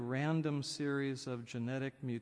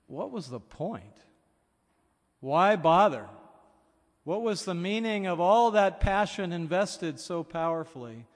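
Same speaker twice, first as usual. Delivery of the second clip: lightly acted challenge, higher and louder; voice of Death; 4 semitones higher, 9 dB louder than usual. Death questioning the point of your passion, a loud challenge. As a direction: demand it as Death, loud after long pauses. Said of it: "What death says is".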